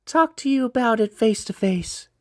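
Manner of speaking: put-on high young woman's voice on the phone; sister's voice. Narrator voicing the sister on the phone, high girlish voice. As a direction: lift it up into the sister.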